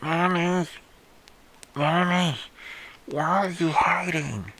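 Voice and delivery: put-on low, distorted ghostly child whisper; creepy whispering child. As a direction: whisper like a dead child hunting. Dead child searching the house.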